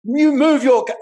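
shouted, high and loud; retold furious cussing. Woman cussing out a driver who took her spot, shouting. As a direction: yell it at her like a venomous driver. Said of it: "This woman was venomous".

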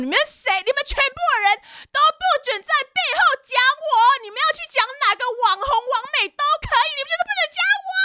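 very high, screamed tirade; mock shrieking. Regina forbids everyone to talk about her, mock shrieking. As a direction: mimic her screeching orders at everyone. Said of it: "不停的就尖叫跟嘶吼".

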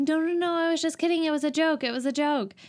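high, flustered no no no; mock panic taking back the joke; imitated girl hurriedly insisting it was a joke; panicked backpedal. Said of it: "'cause she's like".

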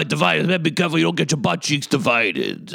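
hammed-up impression of a loud guest. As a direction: bark it like a brash loud guy. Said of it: "loud when he speaks".

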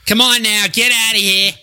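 high Boston bark; Boston accent. Acted. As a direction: shoo them off in a Boston accent. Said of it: "You hear the Boston accent".

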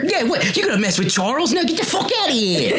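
high, indignant Cockney challenge; upset Cockney host. Acted. Host defending Charles from a fly, upset Cockney. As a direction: pick a fight in high-pitched Cockney. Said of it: "why does the prince sound Cockney".

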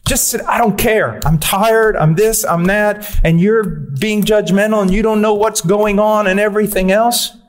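lightly voiced wife fed up with her husband; exasperated wife. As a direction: rattle off her tired complaints. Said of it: "my wife comes out and says".

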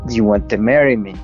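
usual speaking voice

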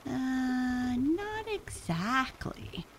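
put-on high, slow, hesitant kid voice; high Buster voice. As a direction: drawl it out, unsure. Buster corrects Sam.

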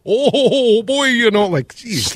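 high, loose mock Minnesota accent with a laugh; comical Minnesota accent. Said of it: "it was comical".

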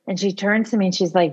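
normal talking voice

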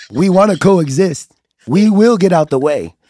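solemn olive-branch speech, played for laughs; mock peace proclamation. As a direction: declare a truce like a formal address. Mock formal peace address.

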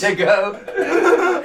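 ordinary talk

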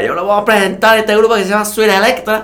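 put-on high, loud Caribbean Spanish accent emcee. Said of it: "acento caribeño".

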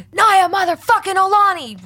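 very high, loud full-name shout; re-enacted yell. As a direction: holler for a daughter across a crowded pool. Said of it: "You're yelling a lot".

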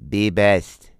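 put-on flat light Slovenian accent; deadpan slogan in a mock accent; Melania impression. Melania answering Michelle's slogan, deadpan mock accent. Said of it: "Melania Trump came right back with".

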